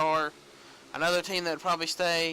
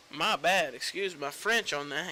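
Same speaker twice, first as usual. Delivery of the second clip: ordinary talk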